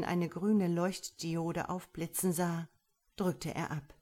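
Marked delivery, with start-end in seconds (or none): none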